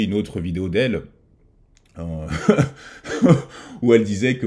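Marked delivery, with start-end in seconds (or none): 2.3-3.8 airy, high burst of laughter without voice; breathy laugh. Real, not acted. Vlogger laughing about the woman's video. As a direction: laugh at how absurd her words are.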